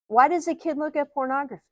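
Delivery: usual speaking voice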